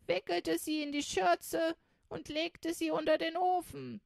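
feigned indifference. Acted. Räubermitzi hiding her surprise from the captain, feigned indifference. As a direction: shrug it off as if nothing. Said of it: "gleichgültig".

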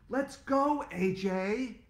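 high loud call up the stairs; yelling across the room. Acted. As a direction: shout up to a kid upstairs. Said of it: "my mom shouted up the stairs".

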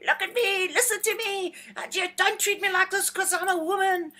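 high-pitched, whiny-protester imitation; mock screaming protest. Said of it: "scream and shout and, and, and say".

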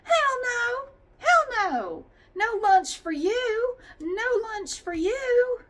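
high, loud, slow mock yell of the bosses; mocking shout. Mocking the bosses who gave no lunch, shouting high. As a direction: bellow it like a gloating boss denying lunch. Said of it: "Did they do that this year?".

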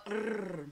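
a steady tongue trill, rrr; rolled r trill. Shown as a demonstration. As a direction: roll the tongue on a long rrr. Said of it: "I can't roll my tongue".

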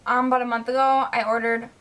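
ordinary talk, nothing marked

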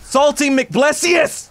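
high, drawn-out salty cry. Real, not acted. Gamer declaring himself salty after dying, mock outburst. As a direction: call out the silly name high and stretched. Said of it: "I'm just salty".

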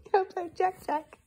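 high coaxing. Shown as a demonstration. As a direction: lift it high to coax the baby.

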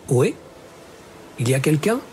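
lightly voiced young girl calling out; clear young girl's voice. A narrator's reading. Young girl calling at the lighthouse door, lightly voiced and clear. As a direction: call up to the lighthouse, a hint of a girl's voice. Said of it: "la voix claire d'une jeune fille".

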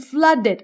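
"flooded" as two syllables, flud-ded; added final syllable. Shown as a demonstration. Teacher shows the last group, added ed syllable. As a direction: draw "flooded" out with an extra ed syllable. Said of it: "ต้องเอื้อนเสียงออกไปเลย เป็น อึด".

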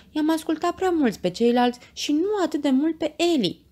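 high-pitched voice for Lucia apologizing; Lucia, apologetic. A narrator's reading. (voce subțire, cu părere de rău)

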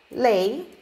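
ordinary talk

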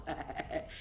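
ordinary delivery

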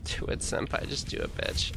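hushed teasing whisper. Pretend. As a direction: breathe it out, unvoiced. Teasing aside to chat.

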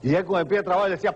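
ordinary delivery

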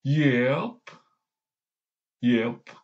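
lightly voiced drawn-out one-word reply; Big Mac. Fan imitating a man of few words, lightly put-on voice. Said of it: "Next is Big Mac".

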